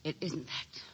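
drained, murmured echo of the denial; hushed repeat. Acted. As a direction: say it again to herself, barely audible.

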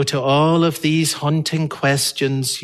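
normal talking voice

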